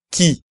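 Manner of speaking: qui said ki, no w; crisp ki for qui; French qui. Shown as a demonstration. Teacher modelling qui for students. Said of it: "en la palabra qui".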